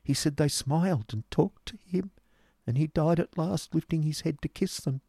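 high, stammering, sorrowful account; pitiful high-voiced recollection, ending on an urgent question; distressed boy's tale. A narrator's reading. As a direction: tell it haltingly in a high, troubled voice. Boy anxiously recounts a friend's death.

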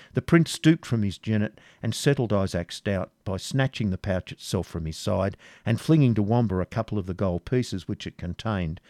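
ordinary delivery, nothing marked

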